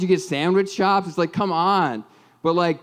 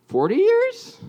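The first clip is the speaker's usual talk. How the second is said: unsure question; slower than usual. Real, not acted. Unsure of his own math.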